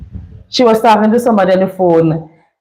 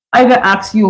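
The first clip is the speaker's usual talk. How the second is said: ordinary delivery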